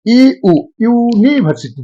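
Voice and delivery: "ई ऊ" slow and high, then glided into "यूनिवर्सिटी"; double vowel sound. Shown as a demonstration. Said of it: "दोनों अक्षर का उच्चारण आता है".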